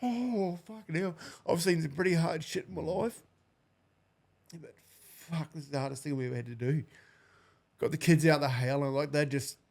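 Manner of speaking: high, strained voice on the edge of tears; choking up as he relives it, pushing words out; voice breaking. Real, not acted. Telling the host how the kids clawed at him, voice breaking with real tears. Recalling handing over his crying kids.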